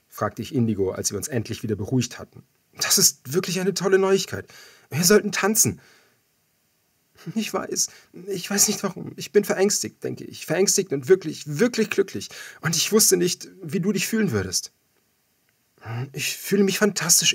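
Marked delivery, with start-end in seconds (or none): 2.7-5.8 higher, elated and a little louder; overjoyed. Acted. Narrator to Indigo after the baby news, overjoyed and high. 7.4-14.7 put-on high, emotional young woman voice; tearful Indigo. Indigo to the narrator, tearful and emotional, high voice. 16.1-17.3 higher, bright and louder; elated. Acted. Narrator to Indigo, elated and higher.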